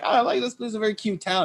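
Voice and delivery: high, soft gushing voice; high and soft, overly sweet praise. Retold or imitated. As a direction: gush about the town in a high sugary tone. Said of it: "He was like".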